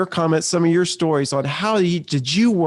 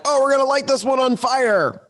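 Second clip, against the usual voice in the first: pitched way up, hammed-up and sarcastic; high mocking put-on voice; mock sarcastic quote; 10 semitones higher than usual. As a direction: toss off a silly pretend line.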